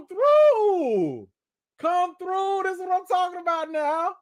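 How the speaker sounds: loud, very high astonished outburst; excited disbelief. Real, not acted. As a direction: cry out in amazed, squealing delight at the total. Donation total jumps to seven hundred dollars.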